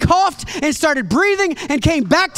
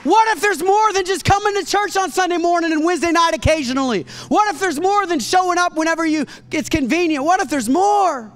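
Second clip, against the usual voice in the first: impassioned preaching; 6 semitones higher than usual. Acted. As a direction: lift them with the question. Preaching appeal.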